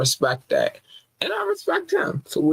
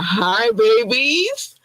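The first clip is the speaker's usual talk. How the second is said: high, drawn-out coo to the fans; cooing greeting; 7 semitones higher, much slower than usual. Real, not acted. Host cooing hello to her overseas fans. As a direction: stretch it out sweetly, way up high.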